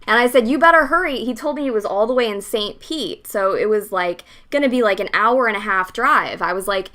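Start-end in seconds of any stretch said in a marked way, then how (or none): 0.5-1.2 stern warning. Retold or imitated. Threatening customer.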